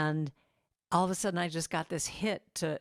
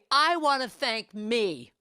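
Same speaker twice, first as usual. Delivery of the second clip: slow, louder and higher, with the stress on "me"; Niecy Nash's proud self-thanks; 4 semitones higher, 6 dB louder, slower than usual. Retold or imitated. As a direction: savour "I want to thank me" like an award winner. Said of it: "She said".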